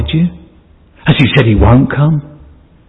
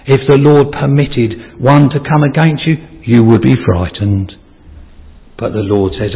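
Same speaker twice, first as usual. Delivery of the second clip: ordinary talk, nothing marked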